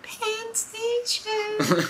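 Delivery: very high, slow sing-song station call; mock conductor announcement. Joking about subway conductors, very high slow mock station call. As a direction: draw it out like a garbled PA.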